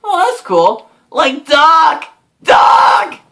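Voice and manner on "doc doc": urgent shouted call, in character; Marty McFly. Acted. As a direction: yell for a friend, building to a full shout. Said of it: "make a Marty McFly costume".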